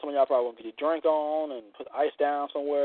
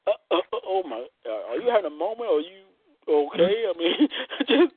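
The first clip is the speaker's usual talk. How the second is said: mock spasm stammer; 4 semitones higher than usual. Acting out a spasm and onlooker.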